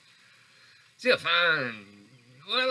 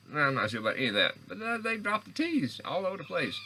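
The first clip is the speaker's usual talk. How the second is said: ordinary talk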